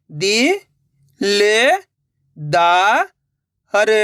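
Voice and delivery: दि, ले, दा, हर drawn out with gaps; syllable by syllable. Shown as a demonstration. Teacher breaking a word for learners, syllable by syllable. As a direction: sound out दि, ले, दा, हर singly. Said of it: "एक अक्षर का उच्चारण करके".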